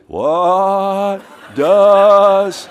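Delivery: very drawn-out, high, loud mock shout; exaggerated slow call. Test taker slowing right down for his friends, drawn-out mock shout. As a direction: stretch each word out absurdly, loud and high. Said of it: "He goes".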